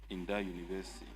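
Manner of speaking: ordinary talk, nothing marked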